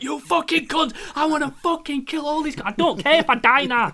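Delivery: high, loud furious yelling; raging shout at the enemies; furious in-game rage. Retold or imitated. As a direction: scream it like you've lost everything and want revenge. Angry rant at game enemies.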